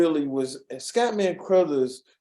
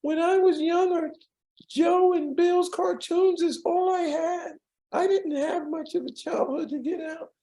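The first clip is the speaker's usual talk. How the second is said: [name] voice. Retold or imitated. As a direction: float it up high and gentle like [name]. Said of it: "He says".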